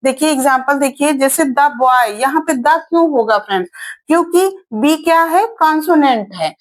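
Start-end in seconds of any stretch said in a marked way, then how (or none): 1.4-2.1 द said short as "da" before boy. Shown as a demonstration. Teacher demonstrating द before a consonant. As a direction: say द with a short neutral vowel. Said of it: "द होता है".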